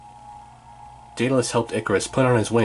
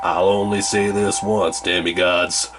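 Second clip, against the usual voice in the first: low, slow southern warning; southern accent; 3 semitones lower, slower than usual. Acted. Rancher warns the demigods to leave, lower southern accent. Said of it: "southern accent".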